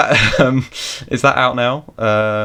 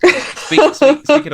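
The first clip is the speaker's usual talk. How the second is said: squeaky high voice; 4 dB louder, much slower than usual. Pretend. Jokey high segue voice.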